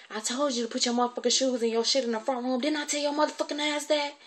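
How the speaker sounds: high-pitched angry scolding; going off. Retold or imitated. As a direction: imitate a guardian going off on a child. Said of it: "she really went off then".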